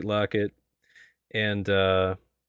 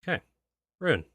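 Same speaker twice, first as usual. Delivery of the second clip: ordinary delivery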